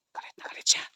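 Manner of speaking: hushed whisper in hiding; whispering in fear. Real, not acted. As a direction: breathe it so she won't hear. Hiding from angry witch.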